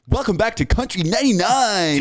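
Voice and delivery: high, hammed-up DJ announcer; cliche radio voice. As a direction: oversell it like a cheesy radio host. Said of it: "it's like".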